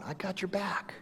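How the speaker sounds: whispered, unvoiced reassurance. [name]'s hushed retort.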